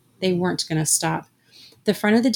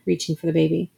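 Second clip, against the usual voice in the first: ordinary delivery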